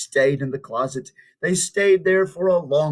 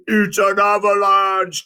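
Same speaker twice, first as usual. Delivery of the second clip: higher, louder, calling out the danger; alarmed cry; 6 semitones higher, 5 dB louder than usual. Acted. Toad warns Frog of the avalanche, crying out. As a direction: shout it like rocks are falling. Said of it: "cried Toad".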